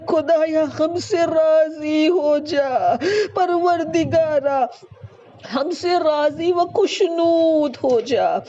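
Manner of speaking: weeping plea, half-sung; tearful prayer. Real, not acted. Preacher leading the congregation in tearful prayer. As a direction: beg forgiveness through tears.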